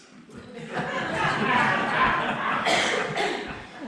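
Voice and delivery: loud, airy burst of laughter; real laugh. Real, not acted. Poet laughing at his book title before the audience, real loud laugh. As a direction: let a big laugh break out at your own joke.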